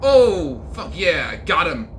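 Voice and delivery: loud, high triumphant whoop; excited cheer. Real, not acted. Streamer celebrating the hero's punch, loud whoop.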